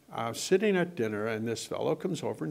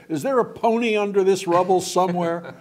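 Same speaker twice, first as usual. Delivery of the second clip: loud, higher teasing question; dad's mock interruption; 6 semitones higher, 9 dB louder than usual. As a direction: cut in loudly like an impatient dad, imitated. Imitates dad cutting him short.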